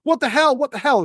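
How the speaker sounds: high shocked cries; startled shouting. Acted. Magnus woken by Sven, shocked high cry. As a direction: blurt it out high and alarmed, just woken. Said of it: "starts shouting and screaming".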